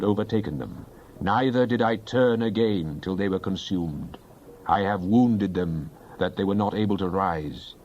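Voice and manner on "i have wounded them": ordinary talk, nothing marked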